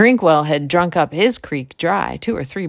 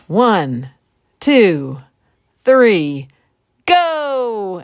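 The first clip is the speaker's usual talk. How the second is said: each count louder, high shout on go; race countdown holler; 5 semitones higher, 5 dB louder, much slower than usual. Acted. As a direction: count them off, building to a yelled go. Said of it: "Jack hollered".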